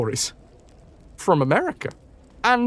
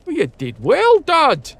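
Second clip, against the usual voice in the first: higher, louder, puffed-out praise as MacLeod; puffing MacLeod; 10 semitones higher, 5 dB louder than usual. Acted. MacLeod praising the hunter, puffing and hearty. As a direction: puff the praise out hearty and high. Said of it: "puffed MacLeod".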